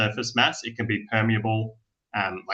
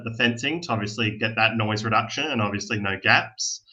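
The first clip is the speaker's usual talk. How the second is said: ordinary talk, nothing marked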